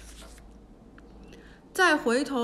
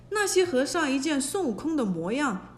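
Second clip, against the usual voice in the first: ordinary delivery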